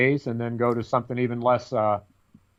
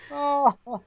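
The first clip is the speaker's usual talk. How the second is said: ordinary talk, nothing marked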